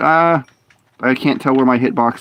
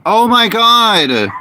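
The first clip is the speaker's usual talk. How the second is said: high, slow drawn-out groan of a phrase; exasperated; 9 semitones higher, 3 dB louder, much slower than usual. Real, not acted. Gamer exasperated by barking dogs. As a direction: stretch it out in exasperation.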